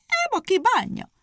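high, ringing hare's call. A narrator's reading. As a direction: call out the bet boldly. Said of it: "kiáltott a nyúl".

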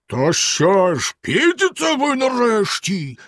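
slow, high, strained yell; drawn-out angry demand, climbing in pitch; furious holler. A narrator's reading. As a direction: bellow impatiently from downstairs. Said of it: "розлючено загорлав знизу синя борода".

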